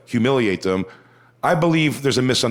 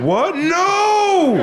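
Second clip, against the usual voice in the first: shrieked high "What? No!"; mock shock; 4 dB louder than usual. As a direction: fake gasping outrage, way up high. Imagined shocked crowd reaction.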